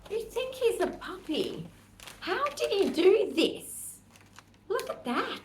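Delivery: high, incredulous; how did he do this, astonished; mock-outraged at the puppy; amazed. Real, not acted. Showing dog's damage to bag.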